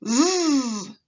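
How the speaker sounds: a loud voiced z sound; buzzing z. Shown as a demonstration. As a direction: hold a buzzing Z so the throat vibrates. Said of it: "Repeat after me".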